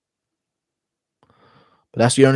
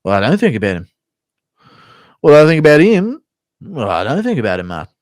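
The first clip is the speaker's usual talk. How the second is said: Whitaker impression; 5 semitones higher, 7 dB louder than usual. Whitaker asked about a rival, weird swooping voice. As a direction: pipe up with the question, then swoop through the answer. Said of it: "Whitaker impression".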